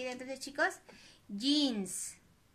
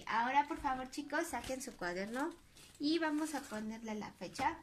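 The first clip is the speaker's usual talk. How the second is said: usual speaking voice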